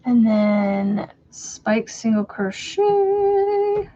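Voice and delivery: slow drawn-out reading. Real, not acted. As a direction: stretch each word while reading.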